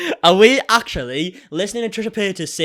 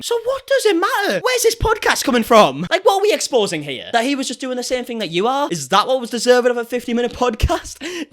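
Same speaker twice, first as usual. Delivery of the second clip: exasperated; 5 semitones higher, 4 dB louder than usual. Real, not acted. Exasperated rant at Trisha.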